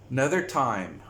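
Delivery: ordinary delivery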